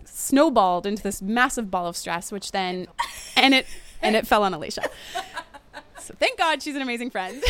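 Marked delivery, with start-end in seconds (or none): 3.4-7.5 amused confession breaking into laughter; laughing. Real, not acted. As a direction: talk through real giggles. Laughing at her own breakdown.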